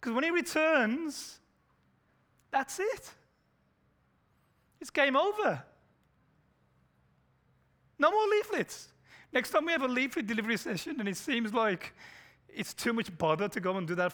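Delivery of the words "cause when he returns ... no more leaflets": high, loud urgent preaching; fervent preaching. Acted. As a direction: build the warning to a peak.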